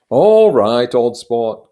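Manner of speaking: loud friendly call to the policeman. Acted. Gadsby calls out to the policeman on the motorcycle, loud. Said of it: "called Gadsby".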